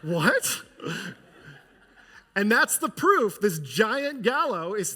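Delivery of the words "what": louder, sudden what; a king caught off guard, mock shock; shocked outburst. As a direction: blurt it out in disbelief. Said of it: "the king's like".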